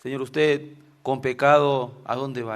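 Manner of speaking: normal talking voice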